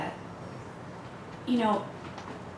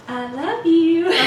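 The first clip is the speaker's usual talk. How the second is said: laughing; 7 semitones higher, 7 dB louder, slower than usual. Acted. Mock tough-love line, laughing.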